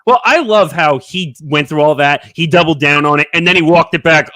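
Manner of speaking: usual speaking voice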